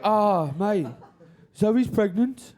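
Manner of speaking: mock-doleful Brummie mate; Birmingham bad-news tone. Mate announcing a pregnancy, sounding like bad news in Brummie. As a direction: break happy news glumly in a Birmingham accent. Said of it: "makes everything sound like bad news".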